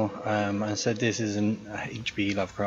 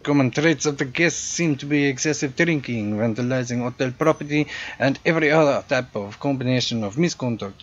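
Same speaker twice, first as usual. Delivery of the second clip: louder, hammed-up French-European accent for a snooty concierge; hotel concierge greeting a guest, in a louder generic European accent; 3 semitones higher, 6 dB louder than usual. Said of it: "my generic European accent".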